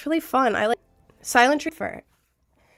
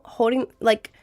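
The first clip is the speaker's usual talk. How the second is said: usual speaking voice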